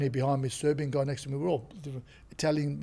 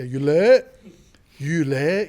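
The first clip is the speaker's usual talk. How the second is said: Yule catchphrase; 9 dB louder, much slower than usual. Retold or imitated. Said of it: "I go".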